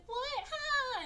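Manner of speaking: very high, hammed-up hurt squeal; mock tearful whine. Rejected girl reacts to being sent away, mock tearful whine. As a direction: imitate an annoying girl about to cry. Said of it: "starts crying and being annoying".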